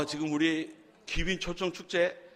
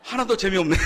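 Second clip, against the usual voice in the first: loud, high, laughing complaint; laughing protest; 6 semitones higher, 8 dB louder than usual. Real, not acted. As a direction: blurt it out and break into laughter. Laughs at own failed joke. (크게 웃음을 터뜨리며)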